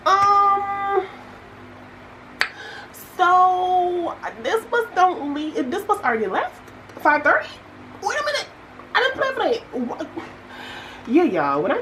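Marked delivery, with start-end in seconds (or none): 0.1-10.1 high-pitched, mock-panicked disbelief; acted disbelief. Traveler realizing the bus already left, acted disbelief. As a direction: play up the dawning shock, voice shooting up. Acting out discovering the missed bus.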